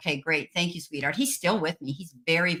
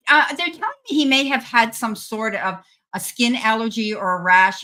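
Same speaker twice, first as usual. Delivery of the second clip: ordinary talk, nothing marked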